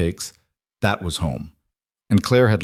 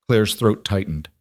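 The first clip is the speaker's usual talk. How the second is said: usual speaking voice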